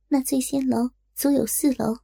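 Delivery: ordinary delivery, nothing marked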